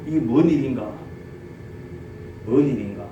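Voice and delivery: Aaron and Hur bewildered by the scene; bewildered musing. Retold or imitated. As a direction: muse in disbelief.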